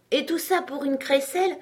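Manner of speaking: high-pitched incredulous exclamation; exclaiming. A narrator's reading. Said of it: "s'exclama Alice". (exclamation incrédule, voix haute)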